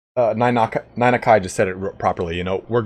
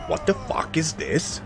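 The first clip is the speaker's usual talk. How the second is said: imitating the angry Swiss boss, slow, soft and hushed; a hushed, slowed mock rant in the boss's voice; 5 semitones higher, 8 dB quieter, slower than usual. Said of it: "'Cause he was always angry".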